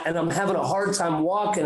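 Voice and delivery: ordinary delivery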